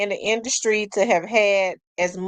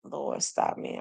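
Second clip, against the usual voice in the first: whispered low, half-laughing at herself; hushed self-scolding whisper; 6 semitones lower, 10 dB quieter than usual. Real, not acted. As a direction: catch yourself in a whisper. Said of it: "I'm talking like this normal. It's not".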